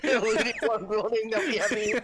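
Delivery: helpless laughter breaking into speech; real laughing. Real, not acted. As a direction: talk while cracking up. Cracking up over wasted bullets.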